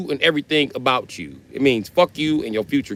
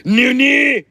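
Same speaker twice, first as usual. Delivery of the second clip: high, loud roaring villain cry; anime villain shout; 12 semitones higher, 6 dB louder than usual. Pretend. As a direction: roar it like a cartoon villain. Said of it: "All the anime villains say that".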